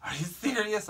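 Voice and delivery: high-pitched mock disbelief; exasperated. Lady imitated on hearing it's just a dent, exasperated. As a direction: imitate her incredulous squawk. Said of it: "she was exasperated".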